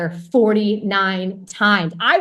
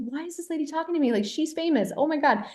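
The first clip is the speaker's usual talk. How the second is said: high, soft, hurried inner voice; retold panicky thought, kept under the voice; starstruck disbelief; 7 semitones higher, 7 dB quieter, faster than usual.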